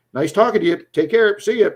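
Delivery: loud, high mock-friendly goodbye; imitating a hasty, dismissive farewell; retold brush-off. Retold abrupt goodbye to rule-breaker.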